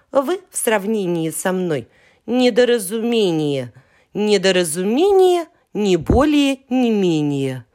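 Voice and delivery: conceited little letter; haughty refusal. Acted. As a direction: look down your nose at them. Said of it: "Отвечает".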